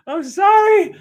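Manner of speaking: very high, squeaky sorry; squealed apology. Real, not acted. Host apologizing again to listeners, high squeal.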